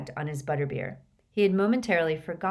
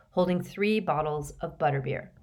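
ordinary delivery

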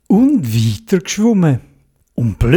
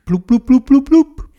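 bubbling sounds; 8 semitones higher than usual. A narrator's reading. Bubbles rising underwater.

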